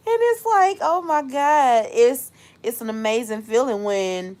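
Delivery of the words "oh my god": ordinary talk, nothing marked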